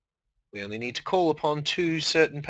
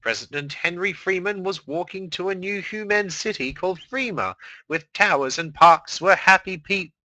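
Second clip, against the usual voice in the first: higher, louder storyteller narration; dramatic story reading; 5 semitones higher, 4 dB louder than usual. Acted. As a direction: read it like an epic saga. Reads fan fiction dramatically.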